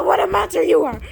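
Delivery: loud high shout; frightened outburst; yell. Acted. Yelling at the monster.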